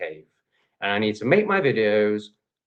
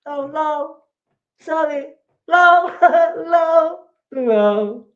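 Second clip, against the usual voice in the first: high, giggly mock of a smug commenter; mocking his reply; 8 dB louder, much slower than usual. Streamer mocks a commenter's reply, high mocking giggle. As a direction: sneer it back in a silly high voice. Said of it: "that's his answer".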